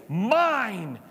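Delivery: loud, firm single word; emphatic claim. Acted. God declaring the universe his own, firm. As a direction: stake the claim with force.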